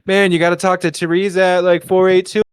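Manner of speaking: high, chummy retold patter; trying for charismatic. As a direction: lay on the friendliness to win them over. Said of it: "charismatic as possible".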